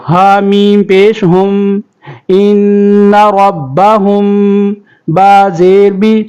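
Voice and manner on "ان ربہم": ان ربہم with the nasal mim held long and slow; with ghunna. Shown as a demonstration. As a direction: hum the mim of ان ربہم through the nose. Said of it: "تو یہاں پہ غنہ ہوگا".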